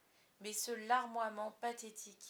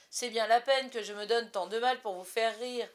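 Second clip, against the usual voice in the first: bitter; 9 dB louder than usual. A narrator's reading. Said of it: "ajouta-t-il amer".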